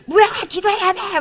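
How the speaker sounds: loud, high calls of each name; retold shouting. Pastor shouting names at students in worship. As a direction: yell them into prayer one by one. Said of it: "소리를 지르면서".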